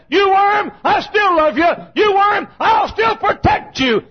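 high, loud shouted preaching; full-force preaching. Acted. Preacher shouting to his congregation, fervent full-force preaching. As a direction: preach it out at the top of your lungs.

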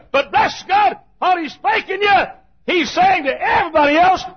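high, loud shouted preaching; full-force preaching. Acted. Preacher shouting to his congregation, fervent full-force preaching. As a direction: preach it out at the top of your lungs.

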